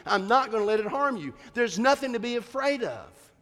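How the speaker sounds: high-pitched, urgent reassuring; acted insistent reassurance. Father promising scared daughter she's safe, acted insistent reassurance. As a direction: drive each promise home emphatically.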